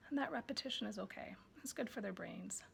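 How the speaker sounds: softly whispered aside. Real, not acted. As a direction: lean in and whisper it.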